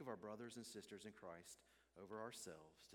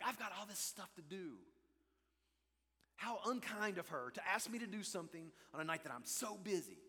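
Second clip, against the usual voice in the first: loud, high indignant tirade; angry inner rant; 7 semitones higher, 8 dB louder than usual. Retold or imitated. Preacher inwardly fuming at being asked a favor, angry rant. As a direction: rant as if silently fuming at her. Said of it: "But inside, I'm like".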